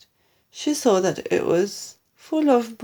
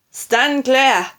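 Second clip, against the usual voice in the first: loud, raised command; warning call; 4 semitones higher, 7 dB louder than usual. A narrator's reading. Husband warning family of the blast, loud call. Husband warns of imminent explosion.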